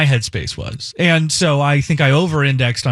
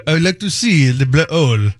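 hammed-up French accent; bad French accent. Host quoting the man in Maui, bad French accent. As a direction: imitate a Frenchman's halting English. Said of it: "this bad French accent".

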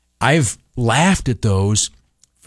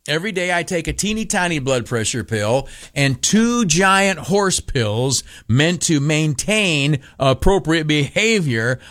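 normal talking voice

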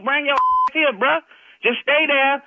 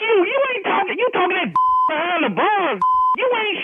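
real furious shout; 8 semitones higher than usual. Real, not acted. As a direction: yell in real outrage. Real husband yells at inmate.

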